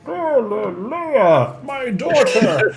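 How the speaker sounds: slow, high, swooping lament; hammed-up, drawn-out character voice; Deckard Cain. Streamer joining in the game-character impression, slow and high. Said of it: "throw a little Deckard Cain".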